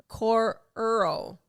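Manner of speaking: cororo drawn out slowly, syllable by syllable; puzzling over how cororo sounds; slow sounding out. Shown as a demonstration. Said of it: "How do you say that?".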